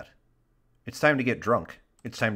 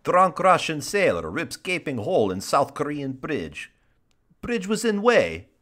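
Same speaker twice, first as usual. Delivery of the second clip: higher-pitched hammed-up Russian accent; 5 semitones higher, 3 dB louder than usual. Host attempting a Russian accent for giggles, hammy Russian accent. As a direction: read the headline like a cartoon Russian. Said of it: "if I can do a Russian accent".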